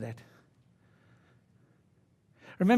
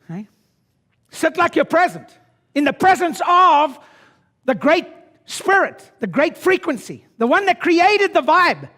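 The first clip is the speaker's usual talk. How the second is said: high, loud revival exhortation; fired-up preaching; 9 semitones higher, 10 dB louder than usual. Acted. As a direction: lead the crowd like a preacher. Preaching at full force.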